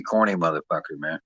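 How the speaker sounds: ordinary delivery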